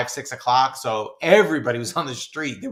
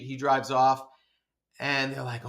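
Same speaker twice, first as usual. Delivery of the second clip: ordinary talk, nothing marked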